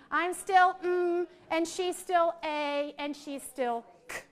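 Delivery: slow, stretched demo of each sound; drawn out sounds. Shown as a demonstration. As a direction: stretch every sound slowly for the class. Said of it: "demo holding phoneme sounds".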